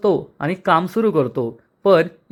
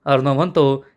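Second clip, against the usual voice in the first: ordinary delivery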